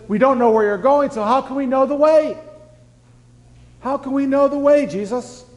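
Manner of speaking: high-pitched baffled disciple; confused protest, voiced high; bewildered questioning. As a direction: plead for an answer, pitch way up. Acts Thomas questioning Jesus, confused.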